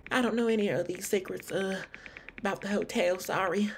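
put-on high-pitched Southern accent; Lauren. Hotel guest answering an investigator, in a high Southern accent. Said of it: "with her talking like this".

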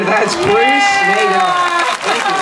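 high, slow showman's announcement; presenter introducing the parrot to the crowd, slow and high. Acted. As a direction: present him like a star act, stretched out. Presenting the bird to the audience.

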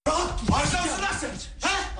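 high confronting yell; high furious challenge; angry high shout. Acted. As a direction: call him out as a troublemaker. Confronting the troublemaker.

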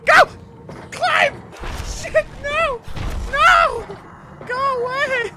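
high, loud frantic shouts; panicked yelling. Real, not acted. Gamer shouts while fleeing the monster. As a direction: yell in a frantic escape.

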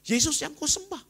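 Yesus sung out high and loud; a preacher's climax on Yesus, high and ringing; rising preacher cry. Real, not acted. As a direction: lift the whole line up high on Yesus.

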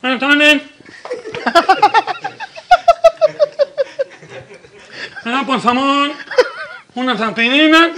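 stuffed-nose customer voice, with laughter; nasal speech impression. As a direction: talk as if the nose were blocked, half laughing. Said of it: "chiste gangoso". (voz gangosa de cliente, entre risas)

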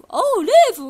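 hammed-up street hawker repeating the name; mock salesman echoing the tourist's name; imitating the salesman.

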